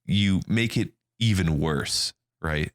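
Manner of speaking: ordinary talk, nothing marked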